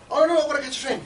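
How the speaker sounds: loud, high hurried protest; acted flustered refusal. Passer-by hurrying past the stall, acted flustered excuse. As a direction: call it out as if rushing off. Acts out passer-by rushing for train.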